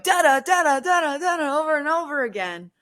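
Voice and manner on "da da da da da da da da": much higher, loud sing-song spirit box chirps; spirit box noise. Retold or imitated. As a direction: mimic the box's repeating chirp, high and loud. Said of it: "he keeps going".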